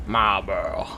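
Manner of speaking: low, soft "Marlboro" like the cigarette; cigarette-brand pronunciation. Shown as a demonstration. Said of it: "sounds like the cigarette brand".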